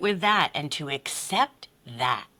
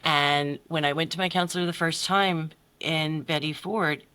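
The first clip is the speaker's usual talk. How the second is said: ordinary talk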